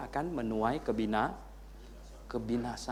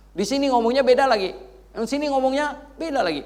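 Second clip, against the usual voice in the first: naik keras; 12 semitones higher, 10 dB louder than usual. Acted. Mengulang dengan makin keras.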